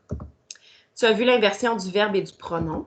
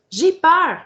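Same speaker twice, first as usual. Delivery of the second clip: higher, louder, a touch of fright; scared line; 8 semitones higher, 7 dB louder than usual. Shown as a demonstration. Teacher demonstrating a frightened character saying it. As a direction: squeak it out with a hint of fear. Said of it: "je te montre".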